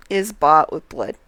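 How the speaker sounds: usual speaking voice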